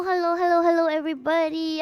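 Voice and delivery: ordinary delivery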